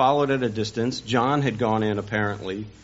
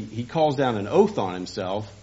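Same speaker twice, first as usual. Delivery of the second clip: ordinary delivery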